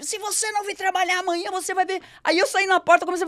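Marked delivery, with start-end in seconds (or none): 0.0-2.0 higher, pointed warning; retold threat. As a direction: threaten with a raised finger. Boss threatening with his finger.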